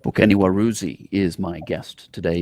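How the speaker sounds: ordinary talk, nothing marked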